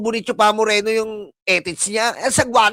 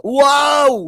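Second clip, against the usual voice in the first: mock wow; 4 semitones higher, 4 dB louder than usual. Reacting to a threat, mock amazement.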